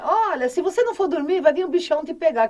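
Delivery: loud, quick bogeyman warning, acted out; scaring parent. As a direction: imitate a parent scaring a child into bed. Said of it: "vamos parar com aquele negócio".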